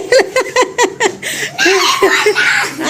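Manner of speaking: long high cackle of laughter; loud laugh. Real, not acted. Mother cracking up at her own joke, loud laughter. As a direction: keep laughing helplessly.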